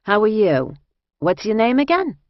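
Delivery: friendly questions from a Jersey woman; Jersey accent. A narrator's reading. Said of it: "she said in a Jersey accent".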